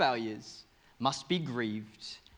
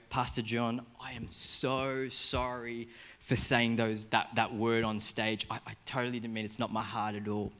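lower, re-enacted contrite apology; sheepish apology; 5 semitones lower, 4 dB quieter than usual. Speaker to his pastor after swearing on stage, sheepish apology. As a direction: apologise with tail between your legs, pitched low. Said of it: "tail between my legs".

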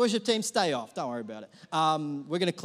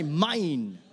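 put-on possessive toddler; 5 dB louder than usual. Shown as a demonstration. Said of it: "A bit more accent".

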